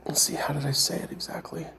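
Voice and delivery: whispered question to self; whispering. Real, not acted. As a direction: murmur it under your breath. Trying to recall the words.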